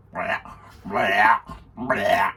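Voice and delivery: repeated mock gagging noises; imitated retching. Friend swallows dark chocolate, mock retching. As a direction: spit out cartoonish retches.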